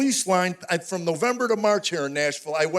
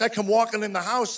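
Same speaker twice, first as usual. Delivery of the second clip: ordinary talk, nothing marked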